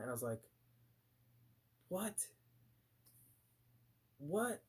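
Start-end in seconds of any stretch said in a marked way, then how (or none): 1.9-4.6 high, rising 'what'; stunned disbelief. Retold or imitated. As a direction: react aghast, pitch jumping up. Stunned reaction to the sign.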